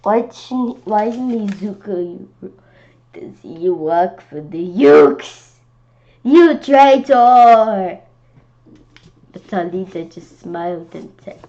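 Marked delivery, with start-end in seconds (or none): 0.0-8.0 slow, loud, higher furious shout; angry accusation. Acted. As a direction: hurl the word traitor at him. Accusing traitor in read story.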